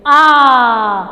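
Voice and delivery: आ very loud and long; heavy stress. Shown as a demonstration. Teacher showing children the stress, आ loud and sustained. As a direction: put full force on आ and hold it. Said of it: "आ पे ज़्यादा जोर दोगे बच्चों".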